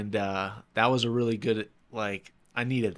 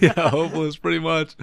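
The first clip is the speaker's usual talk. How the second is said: laughing; 6 semitones higher, 6 dB louder than usual. Real, not acted. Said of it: "Is that how you felt?".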